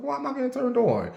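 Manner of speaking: squeaky high-pitched mock alarm; high mock surprise. Telling viewers how a voice message made her feel, in a squeaky high voice. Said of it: "I was like".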